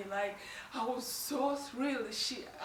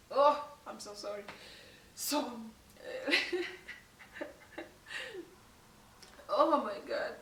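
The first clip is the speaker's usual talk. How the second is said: emotional, laughing through tears. Real, not acted. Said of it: "this is so emotional".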